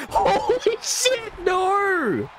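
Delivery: high, slow, laughing holy shit; laughing disbelief. Real, not acted. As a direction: let the laugh lift the words way up. Reacting to a surprise move.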